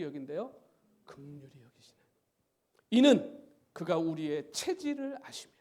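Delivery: slow solemn Bible reading fading to a whisper; scripture reading. Acted. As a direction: read the psalm reverently, sinking to a hush. Reads the psalm aloud. (엄숙한 성경 낭독, 끝은 속삭이듯)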